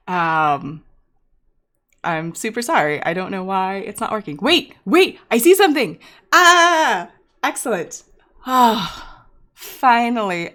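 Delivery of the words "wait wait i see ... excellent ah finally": sudden high, loud relief; real burst of excitement; excited. Real, not acted. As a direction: it finally works, yelp with joy. Stream finally starts working.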